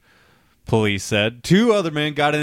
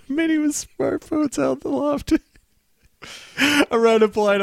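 high-pitched, helpless giggly reading; laughing while reading; 13 semitones higher than usual. Real, not acted. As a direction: try to read on through the laughter. Reader cracks up at "bongs.

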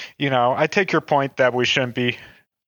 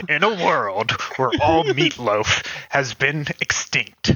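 movie trailer voice. Pretend. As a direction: intone it like an epic trailer. Mock movie trailer narration.

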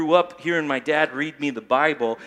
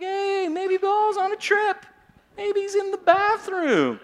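laying it on thick; 11 semitones higher, 3 dB louder than usual. Retold or imitated. Elijah joking Baal is on the toilet, hammed-up high sarcasm. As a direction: suggest silly excuses with exaggerated sympathy. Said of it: "he is laying it on thick".

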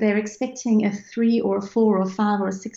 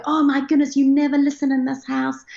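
higher mock-exasperated grumble; grumpy parent; 6 semitones higher, 4 dB louder than usual. Mother joking with her kids in a couch game, mock-grumpy. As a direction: pretend to scold like a grumpy parent. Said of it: "I sound like a really grumpy parent".

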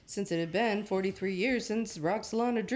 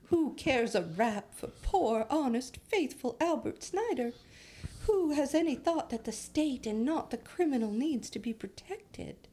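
higher-pitched, put-on old Times accent; mock New York Times accent; 5 semitones higher than usual. Podcaster quoting an old editorial, mock Times accent. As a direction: read it in a hammed-up Times accent. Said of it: "why the New York Times talk like that".

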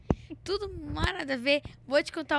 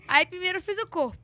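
laughing; 5 semitones higher, 7 dB louder than usual. Real, not acted. Laughing telling story.

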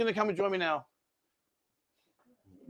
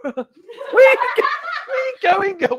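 high, loud laughing; 12 semitones higher, 4 dB louder than usual. Real, not acted. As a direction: laugh through the words. Laughing at girl falling.

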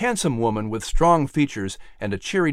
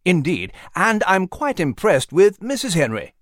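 gentleman Nigel; 6 semitones higher than usual. Acted. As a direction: dryly count them off like a gentleman. Nigel answers Liz.

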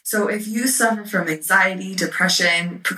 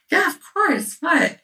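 much higher-pitched, lilting mock-eager reply; retold eager reply; 12 semitones higher than usual. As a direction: act out an unsuspecting, breezy answer. Retold chirpy reply before bad news.

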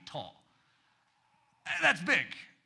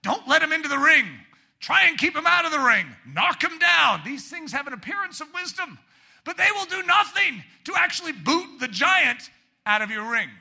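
full-force preaching; 8 semitones higher, 8 dB louder than usual. Acted. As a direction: drive it home from the pulpit.

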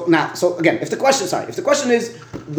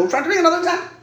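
"bring" jumps much higher on the repeat; emphatic repeat; 12 semitones higher, faster than usual. Shown as a demonstration. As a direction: repeat "we're trying to bring another example" pitched way up to drive it home.

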